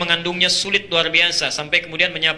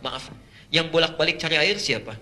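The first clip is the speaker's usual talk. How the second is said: normal talking voice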